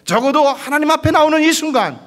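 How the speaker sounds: high, loud preaching cry; full preaching cry. Real, not acted. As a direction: cry it out over the congregation. Preacher's climactic opening cry. (높고 크게 외치는 설교)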